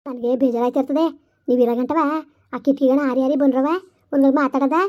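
low, eerie ghost warning of the trafficker. Acted. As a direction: speak low like a knowing ghost. Ghost voice again. (ತಗ್ಗಿದ ದೆವ್ವದ ಧ್ವನಿ)

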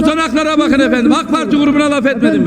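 heated, fast shouted denial; loud protest. Real, not acted. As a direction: insist loudly over the chair. Shouting over interruptions.

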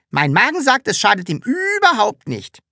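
pleased, know-it-all Sams. A narrator's reading. Sams quoting its stomach.

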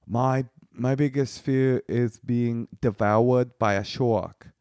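put-on slow South African accent with softened R's; South African childlike accent. Comedian retells an audience member's fear, imitating her South African accent. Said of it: "like Charlie bit my finger".

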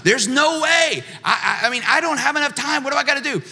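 high-pitched, stammering panic; panicked thought. Retold or imitated. Thinking to himself about having no time, in high panic. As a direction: squeak it out like he's overwhelmed.

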